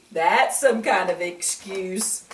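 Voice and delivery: ordinary delivery, nothing marked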